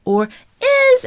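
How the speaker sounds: high loud detached or is; separate hit, wrong. Shown as a demonstration. Coach showing the harder unlinked way. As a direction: hit is on its own, disconnected. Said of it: "as opposed to going something like".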